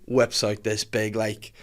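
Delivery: ordinary delivery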